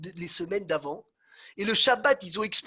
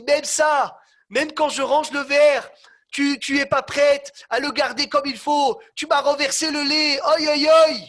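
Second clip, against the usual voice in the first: high, loud ranting ending in a shout; yelling chassid; 8 semitones higher, 9 dB louder than usual. Acted. Chassid yelling at his wife over the milk, loud. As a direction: rage at her for spilling the milk. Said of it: "Il se met à hurler".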